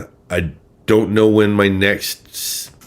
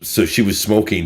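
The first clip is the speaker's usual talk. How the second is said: usual speaking voice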